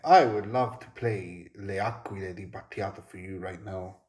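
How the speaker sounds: plain reading voice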